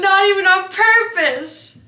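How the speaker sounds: high, loud, delighted echo; excited. Real, not acted. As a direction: squeal it out in delight. Gleeful echo of partner.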